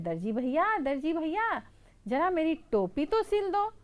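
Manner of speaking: high, pleading little mouse voice. Acted. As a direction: call out sweetly to the tailor. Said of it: "तो चूहा बोलता है". (ऊँची, मिन्नत भरी चूहे की आवाज़)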